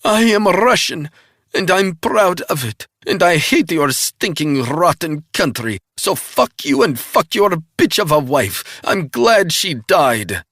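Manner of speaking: high, loud hateful tirade; Russian spy cursing America and his wife; hateful Russian defiance. A narrator's reading. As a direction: pour out every ounce of hate. Russian villainess held voice.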